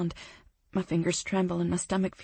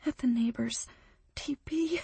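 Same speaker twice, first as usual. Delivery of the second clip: sniffling; 6 semitones higher, 4 dB quieter than usual. Acted. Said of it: "she sniffles".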